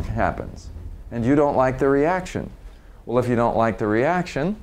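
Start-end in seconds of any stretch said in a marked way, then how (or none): none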